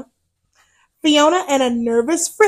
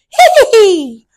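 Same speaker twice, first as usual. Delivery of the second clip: high, louder playful cackle; witch cackle; 6 semitones higher, 6 dB louder than usual. Acted.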